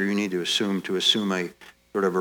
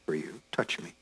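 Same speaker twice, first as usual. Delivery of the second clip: tender hush; 3 semitones lower, 8 dB quieter than usual. Acted. Jesus' voice, tender offer.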